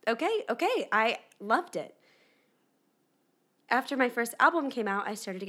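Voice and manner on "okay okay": high, bright eager okay okay; seventeen-year-old saying yes to everything, eager okays. Retold or imitated. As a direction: chirp agreement like a keen teen. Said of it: "I said".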